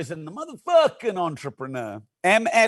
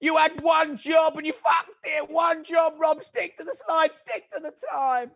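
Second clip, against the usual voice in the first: higher, shouty mock scolding from a colleague; mock telling-off; 7 semitones higher than usual. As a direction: ham up a boss yelling at Rob. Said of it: "Callum will be like".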